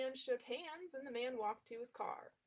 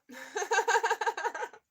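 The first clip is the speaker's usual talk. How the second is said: loud high burst of laughter; 9 semitones higher, 9 dB louder than usual. Real, not acted. Reader laughing loudly at his own fake accent. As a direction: crack up laughing at yourself.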